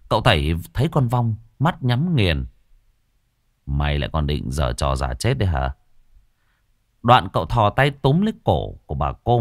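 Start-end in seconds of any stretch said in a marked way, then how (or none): 3.7-5.7 a hint of a stern exorcist's menace; menacing exorcist. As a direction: lean in and warn the spirit coldly. Exorcist taunts the ghost.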